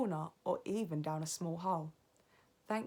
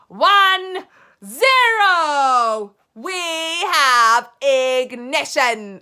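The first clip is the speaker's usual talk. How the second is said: countdown building higher and louder; 6 semitones higher, 17 dB louder, much slower than usual. Acted. Grand High Witch counting down to ignition, building. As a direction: climb to a triumphant launch.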